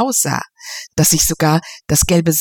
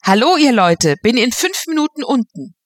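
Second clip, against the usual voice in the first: louder call up the house; a cheerful call to everyone; calling loudly; 6 semitones higher than usual. Acted. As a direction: shout a greeting from the hall. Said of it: "Harry rief laut".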